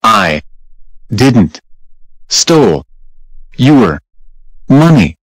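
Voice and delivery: loud, spaced-out, one word at a time; slow angry denial. Acted. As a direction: hammer each word out slowly.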